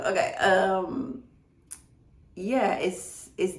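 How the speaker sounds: holding back real tears; steady yourself while choked up; emotional. Real, not acted. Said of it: "I'm gonna get emotional".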